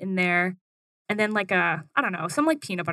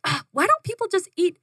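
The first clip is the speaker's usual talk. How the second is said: excited rant; 7 semitones higher than usual. Retold or imitated. To Joey on a ski trip, an excited food rant. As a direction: rant with mock frustration about food. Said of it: "I was on my rants about food".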